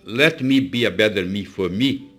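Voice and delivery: slow, deliberate English quote; slow recited motto. Shown as a demonstration. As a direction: spell the motto out slowly, word by word. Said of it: "Ou seja".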